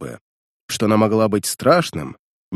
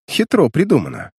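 higher, mildly spiteful remark; malicious smile; 6 semitones higher than usual. A narrator's reading. Nick Carter comments on Rudolf's asylum transfer, with mild malice. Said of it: "заметил Ник Картер со злобной улыбкой".

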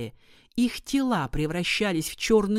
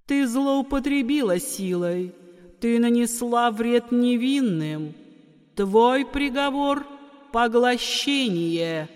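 stern goddess; 6 semitones higher, 4 dB louder than usual. Acted. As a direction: pronounce the verdict from above. Goddess pronounces the sentence.

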